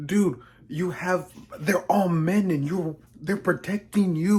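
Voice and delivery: high, loud exasperated rant; outraged disbelief. Real, not acted. Narrator ranting at feminists about male police, outraged. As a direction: throw your hands up at the hypocrisy.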